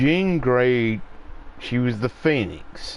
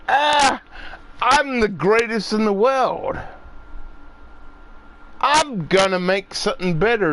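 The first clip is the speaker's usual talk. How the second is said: mocking arrogant filmmakers; 8 semitones higher than usual. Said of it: "these people are like".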